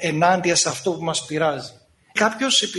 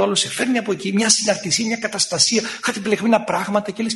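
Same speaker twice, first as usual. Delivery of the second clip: normal talking voice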